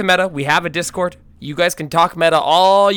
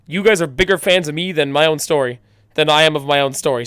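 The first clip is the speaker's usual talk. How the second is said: ordinary talk, nothing marked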